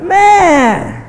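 high, loud outburst; exasperated shout. Acted. Preacher fed up with constant grumblers, exasperated shout. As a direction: burst out, fed up.

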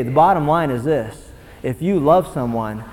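ordinary talk, nothing marked

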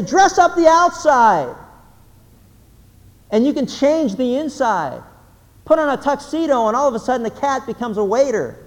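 high, loud preacher's build; fiery sermon climax; preaching. Acted. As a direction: drive it home from the pulpit. Sermon rising to full preaching.

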